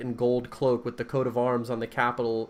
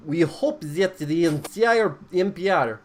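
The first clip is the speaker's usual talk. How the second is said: put-on laughing through accent; 7 semitones higher, 10 dB louder, slower than usual. Laughs mid-sermon.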